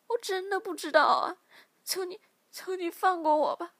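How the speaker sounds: high, loud tearful pleading; terrified captive pleading; desperate begging. Acted. Captive begs for mercy.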